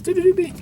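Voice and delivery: playful high doodling sound; high sing-song noise. Acted. Showing how the symbol is drawn, high playful noise. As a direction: trill it high, like a little jingle.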